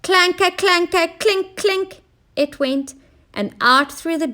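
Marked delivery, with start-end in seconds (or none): 0.0-2.0 high, mechanical clanking; clanking noise. Acted. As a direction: imitate a clunky machine. Machine noise imitated.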